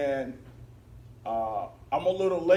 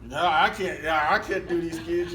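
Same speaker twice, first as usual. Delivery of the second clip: exasperated teachers; 3 semitones higher, 7 dB louder than usual. Retold or imitated. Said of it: "And they were going".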